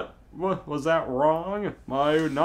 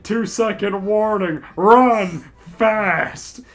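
urgent warning; 5 semitones higher, 6 dB louder, slower than usual. Acted. Mayu warns before shooting.

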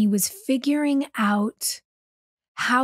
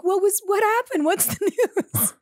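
higher, laughing puzzled question; curious onlookers; 9 semitones higher than usual. Retold or imitated. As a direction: ask what happened, amused and high. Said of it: "when I left were like".

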